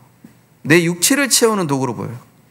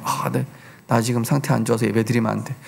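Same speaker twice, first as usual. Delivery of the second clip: 풀 죽은 혼잣말; 8 semitones lower, 8 dB quieter than usual. Pretend. 죄책감에 예배를 피하는 사람.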